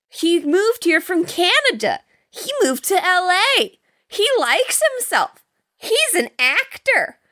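high-pitched mimicry of a girl; imitated girl. Retelling the girl talking to Cardenas, high mocking imitation. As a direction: parrot the girl in a high, exaggerated voice. Voices girl repeating facts about man.

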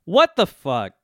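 astonished reaction, replayed; retold disbelief. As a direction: re-enact being baffled. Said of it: "I just said".

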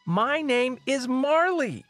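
high, slow, drawn-out outburst; screamed correction. Acted. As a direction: scream it out one word at a time. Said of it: "she screams".

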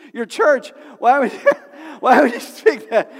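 loud, high burst of exhortation; preaching outburst. Real, not acted. Building outburst in sermon.

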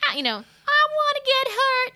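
high, loud put-on Brooklyn tough guy; demoing a Brooklyn accent for the composer, loud and high. Shown as a demonstration. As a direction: ham up a New York Brooklyn voice. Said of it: "Brooklyn accent".

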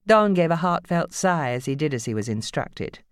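ordinary delivery

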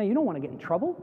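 ordinary delivery

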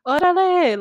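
a high, loud yelled Órale; loud, high mocking shout. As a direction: bark it across a parking lot like a jokey stranger. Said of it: "some guy in the same parking lot was like".

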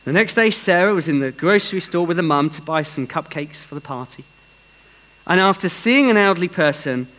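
normal talking voice

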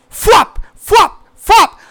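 high imitated flapping sounds; playful imitation of rooster wings; flapping noise. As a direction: mimic wings beating by the window. Imitating wings flapping outside window.

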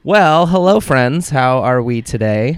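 slow, welcoming host voice; a touch of a hammed-up host; host greeting. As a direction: greet the listeners like a showman. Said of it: "host greeting voice".

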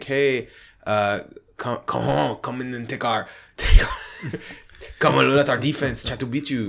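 hammed-up French accent, loosely done; a jokey French coach accent with a laugh; mock French accent. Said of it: "that's my French accent".

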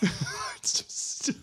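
slow, whispered through laughter; mock the title with a laugh; breathy laughing echo. Echoing title mockingly.

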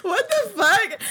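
high, loud laughing cry; real shocked laughing yell; laughing shout. Real, not acted. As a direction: blurt it out through laughter. Laughing outburst.

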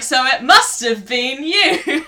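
slow, drawn-out reveal with a laugh; a DVD host's slow verdict, laughing through it; dramatic reveal. Retold or imitated. Retelling the host naming her the murderer, slow and laughing. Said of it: "And he's like".

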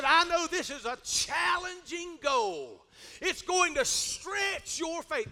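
slow, high, stretched key words; drawn-out encouragement. Acted. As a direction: draw out the challenge to spur someone on. Acts bishop's encouragement, drawn out.

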